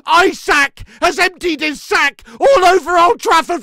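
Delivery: real outraged shouting, high and very loud; furious rant. Real, not acted. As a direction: yell in exasperated disbelief at your team. Rages at early conceded goal.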